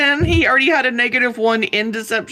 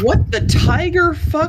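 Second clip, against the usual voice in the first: shocked outburst; 7 semitones higher, 4 dB louder, slower than usual. Real, not acted. Sudden loud video noise.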